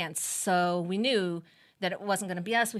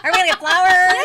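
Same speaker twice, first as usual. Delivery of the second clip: much higher and louder, giddy question; eager guessing; 11 semitones higher, 13 dB louder than usual. Pretend. Mother guessing the surprise, giddy eager question. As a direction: play giddy and expectant. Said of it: "here's us with these grins".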